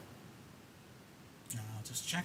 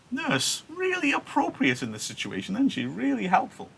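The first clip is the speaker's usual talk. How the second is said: sarcastic; 11 semitones higher than usual. Real, not acted. Ironic remark on the nurse.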